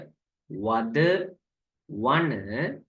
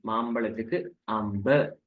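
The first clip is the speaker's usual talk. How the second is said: ordinary delivery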